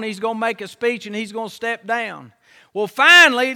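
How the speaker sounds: ordinary delivery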